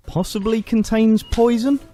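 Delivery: mock clerk. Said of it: "he rejoined".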